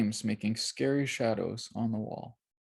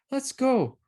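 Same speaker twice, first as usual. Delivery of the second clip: put-on eager girl; 14 semitones higher, 3 dB louder than usual. A narrator's reading. Mercy eager.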